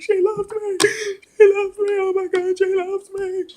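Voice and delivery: high, very loud ecstatic shriek; re-enacted screaming. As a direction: scream it out like an overwhelmed fan. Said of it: "why are you screaming".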